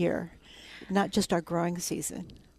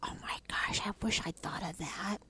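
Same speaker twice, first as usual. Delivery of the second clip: hushed, in awe; 8 dB quieter than usual. Retold or imitated. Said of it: "you think".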